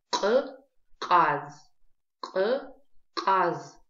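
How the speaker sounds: «К-каз» громко, с отдельным резким «к»; отдели гортанное «к», затем протяни «каз»; гортанное к в слове. Shown as a demonstration. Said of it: "мы запоминаем звук". («к-каз» по частям, как образец)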